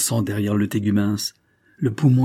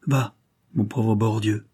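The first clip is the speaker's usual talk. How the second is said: usual speaking voice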